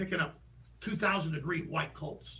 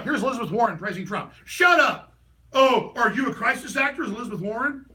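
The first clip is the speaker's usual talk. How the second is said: loud, laughing taunt; loud mocking retort; 5 semitones higher, 9 dB louder than usual. Retelling his tweet to leftists, loud laughing taunt. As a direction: throw it back at them with a laugh.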